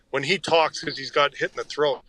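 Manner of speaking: normal talking voice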